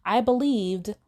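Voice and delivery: ordinary talk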